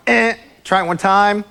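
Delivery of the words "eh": short "eh" buzzer imitation; buzzer noise. Shown as a demonstration. Host shows audience how to buzz players out, buzzer noise. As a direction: buzz like a game-show fault buzzer. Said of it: "buzz them out by going".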